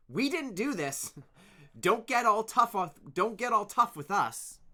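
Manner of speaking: loud high protest. Acted. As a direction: stand up to him. Kid protesting to leader.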